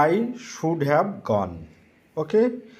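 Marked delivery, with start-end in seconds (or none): 0.1-1.5 each word of I should have gone spelled out slowly; slow, careful dictation. Shown as a demonstration. Teacher dictating the English sentence to students, slowly. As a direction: say it slowly so the class can write it down. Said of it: "কি লিখতে পারি ইংরেজিটি?".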